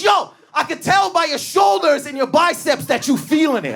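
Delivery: high, hollered hard sell; hammed-up shouted pitch; mock sales yell. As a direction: imitate a salesman bullying with flattery. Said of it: "their sales tactic is just to yell at you".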